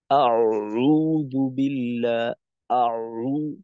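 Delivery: আউযু বিল্লাহ with the ain pressed far too hard and slow; overstressed ain. Shown as a demonstration. Teacher shows the wrong heavy ain, slow and forced. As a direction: push too hard on the ain to show the mistake. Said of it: "এই যে এতটা চাপ".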